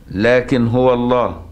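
slow chanted recitation; Quran recitation. Shown as a demonstration. Teacher chanting the Kisai reading for students.

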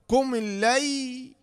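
slow "قم الليل" as qumi-llay, middle alif silent; linked syllable spelling. Shown as a demonstration. Teacher showing the silent middle letters, slow linked "قم الليل". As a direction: join "قم" straight into the doubled l of "الليل". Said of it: "كل اللي في الوسط هذا لا ينطق".

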